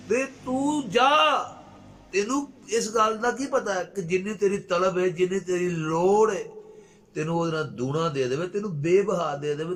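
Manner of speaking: high, drawn-out loud exhortation easing gradually; impassioned preacher in full voice; fervent preaching. Real, not acted. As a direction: urge them on with a soaring cry, then settle.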